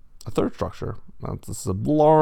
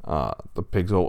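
ordinary delivery

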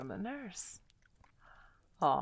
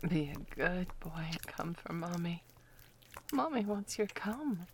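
ordinary delivery

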